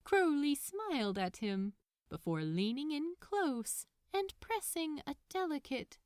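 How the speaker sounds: usual speaking voice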